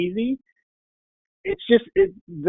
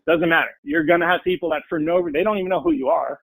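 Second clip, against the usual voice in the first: usual speaking voice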